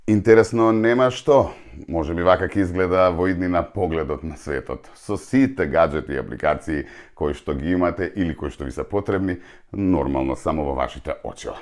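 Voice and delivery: ordinary talk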